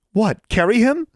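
very high, loud strained protest; taut, near breaking. A narrator's reading. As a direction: voice stretched to snapping point. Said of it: "Anna's voice was like a taut wire about to break".

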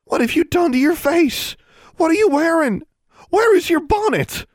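very high, loud shocked sister; appalled scolding. A narrator's reading. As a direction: fire off outraged questions. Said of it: "looked as appalled".

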